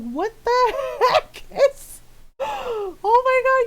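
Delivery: high, drawn-out laughing retelling; laughing disbelief. Retold or imitated. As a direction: retell your shock through laughter. Acting own baffled reaction, laughing.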